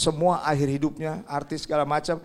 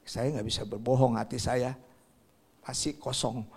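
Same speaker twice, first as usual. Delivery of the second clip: lower, softer, understated sorrow; mildly downcast confession; 4 semitones lower, 6 dB quieter than usual. Acted. Comedian confessing to a reporter, understated low sadness. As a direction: admit it low and subdued. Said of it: "dia nangis".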